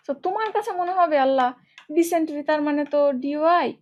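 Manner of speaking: ordinary talk, nothing marked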